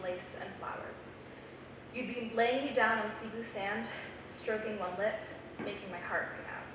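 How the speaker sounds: reads the poem softly and evenly; poem recital. Acted. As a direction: savour each line of the love poem.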